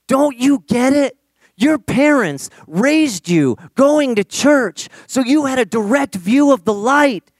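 loud, high, urgent pleading; all worked up. Retold or imitated. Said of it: "he just gets all worked up".

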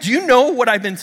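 high, loud incredulous burst; high-pitched amazement; amazed question. Retold or imitated. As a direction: retell it in excited disbelief. Acted amazed question to friend.

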